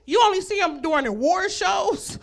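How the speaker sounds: normal talking voice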